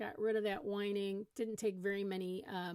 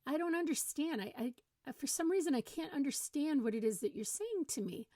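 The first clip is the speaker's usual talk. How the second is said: higher-pitched put-on puzzlement; playing dumb; 6 semitones higher than usual. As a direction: act baffled, as if unable to understand. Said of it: "try using the selectively stupid".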